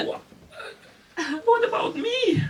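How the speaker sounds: high, tearful mock plea; wounded whine. Rejected man pleading with the couple ignoring him, wounded whine. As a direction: squeal it like a jilted suitor.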